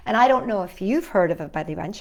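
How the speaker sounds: normal talking voice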